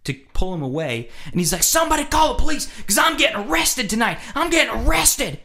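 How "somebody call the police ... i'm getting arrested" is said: high, fast hollering; mock drunk yelling. Drunk guy as girlfriend pulls him away, mock hollering. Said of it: "he's yelling at him".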